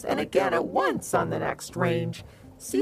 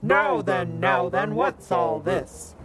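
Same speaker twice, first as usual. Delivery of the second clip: booming voice; 4 dB louder, slower than usual. Acted. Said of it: "heard a booming voice say".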